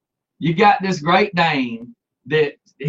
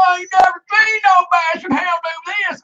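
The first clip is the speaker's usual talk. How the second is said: mock-astonished holler; 12 semitones higher than usual. Dog catcher struggling with a dog that's biting him, mock-astonished wail. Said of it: "He's like".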